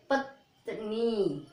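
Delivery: पत्नी drawn out slowly, the half त tucked quickly into the नी; पत्नी spoken slowly as a model word for pupils; slow teaching demo. Shown as a demonstration. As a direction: sound out पत्नी very slowly for learners. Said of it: "आधा ता कैसे बोलोगे".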